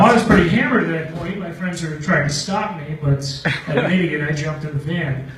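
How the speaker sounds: normal talking voice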